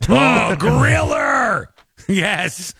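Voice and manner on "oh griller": high, slow delighted shout with a laugh; laughing cheer. Real, not acted. Cheering the tough interviewer.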